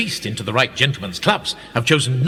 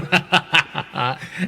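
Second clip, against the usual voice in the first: a short amused chuckle; light laugh; 4 semitones higher than usual. Acted.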